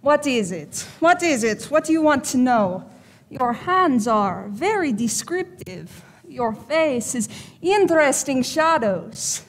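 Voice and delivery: showy Eastern European fortune teller; fake Eastern European accent. Psychic putting on a show for a client, fake Eastern European accent. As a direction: play the all-knowing mystic with an Eastern European accent. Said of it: "when it comes from Eastern Europe".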